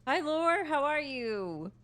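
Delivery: bright greeting. Real, not acted. Greets a game character brightly.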